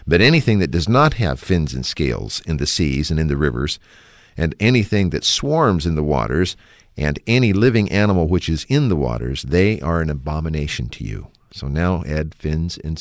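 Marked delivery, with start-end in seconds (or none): 0.1-11.3 louder, measured Bible reading; loud, measured reading of scripture. Acted. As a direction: read the verse out with weight. Reads scripture verses aloud.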